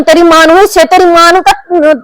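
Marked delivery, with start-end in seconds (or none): none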